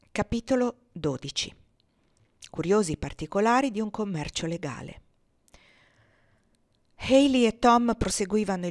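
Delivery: steady novel reading voice; story narration. A narrator's reading. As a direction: take up the chapter and read on.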